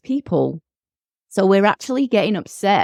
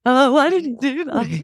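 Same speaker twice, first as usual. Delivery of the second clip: touched parent; 7 semitones higher than usual. Retold or imitated. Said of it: "And you're like".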